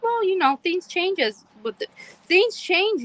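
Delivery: high, lightly mocked voice of her teenage son; mocking son. Mother imitating her son brushing off her complaint, high mock voice. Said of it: "He's like".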